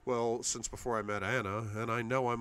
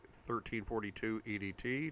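ordinary delivery

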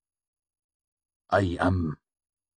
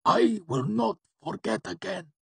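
very high, strained promise; weakened, pleading vow; earnest gasp. A narrator's reading. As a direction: gasp it out earnestly, drained by agony. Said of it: "he gasped out earnestly".

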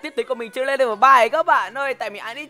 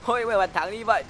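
ordinary talk

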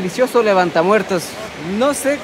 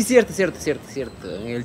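trailing off softer and lower each time; fading to a mumble; 9 semitones lower, 6 dB quieter than usual. Real, not acted. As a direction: let each repeat die away. Remembering the topic, trailing off. (apagándose poco a poco)

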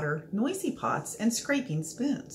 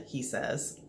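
plain reading voice

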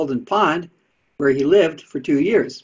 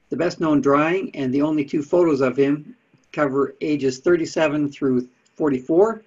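usual speaking voice

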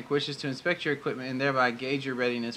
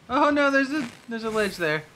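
high, louder sudden alarm; 10 semitones higher, 4 dB louder than usual. Real, not acted. Player spots ledge ahead, startled and high. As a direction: react like you're about to fall.

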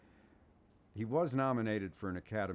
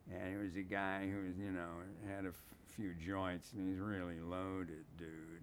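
stoner voice; 5 dB quieter than usual. Shown as a demonstration. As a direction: talk like a guy who's had a few joints. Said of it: "my all-purpose stoner voice".

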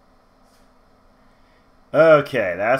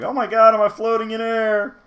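surprised; 13 semitones higher, 10 dB louder than usual. Retold or imitated. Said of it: "and I was like".

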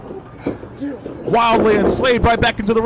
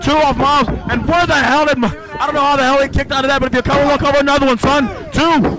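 normal talking voice

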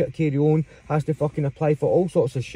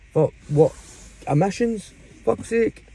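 higher, disbelieving emissions then fed-up curse; can't believe it's about emissions; incredulous exasperation; 5 semitones higher than usual. Real, not acted.

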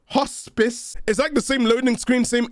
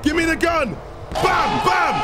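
gleeful victory shouting; excited shouting; 6 semitones higher than usual. Real, not acted. Celebrating victory.

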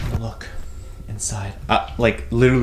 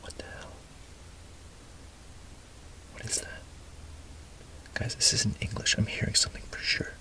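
hushed listening whisper; 9 semitones higher than usual. Pretend. Whispering to viewers while listening for a voice in the box, hushed and uneasy. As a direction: whisper so as not to drown out a faint sound.

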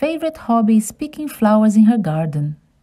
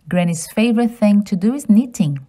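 usual speaking voice

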